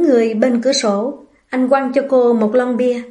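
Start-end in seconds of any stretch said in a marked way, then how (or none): none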